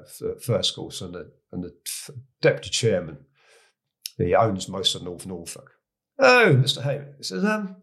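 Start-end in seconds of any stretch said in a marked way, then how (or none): 6.2-7.8 raised, much higher voice of a landed gentleman; country gentleman. Retold or imitated. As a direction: play the toff squire leaning in with a question. Deputy chairman quoted at lunch.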